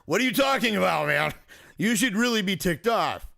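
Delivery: indignant outburst. Retold or imitated. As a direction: blurt it out in disbelief to your son. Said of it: "I'm like".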